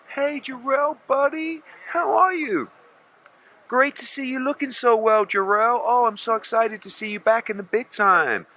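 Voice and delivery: very high, slow, syrupy fake greeting; two-faced fake friendliness. Mocking two-faced boxing people with a sugary greeting. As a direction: gush like a phony friend. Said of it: "this is most people in boxing".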